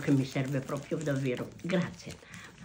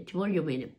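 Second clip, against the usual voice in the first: ordinary talk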